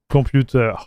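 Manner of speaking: usual speaking voice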